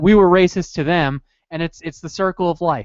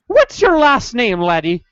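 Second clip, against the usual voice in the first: mock Irish clerk; 5 semitones higher, 4 dB louder, slower than usual. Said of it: "so they're like".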